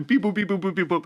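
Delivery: high beeping robot noises; toy robot voice. Retold or imitated. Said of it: "sitting there going".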